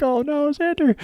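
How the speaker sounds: high, mock-dismayed squeal; mock dismay, high-pitched. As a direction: squeak it out in fake horror. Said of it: "And you're like".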